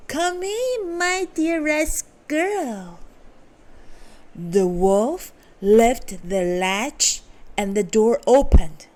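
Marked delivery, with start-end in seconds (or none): 0.1-3.0 put-on high old granny voice, slow at the end; grandmother calling out. A narrator's reading. Grandmother in bed calling the visitor in, high and slow. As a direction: call out sweetly like a grandma. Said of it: "called out".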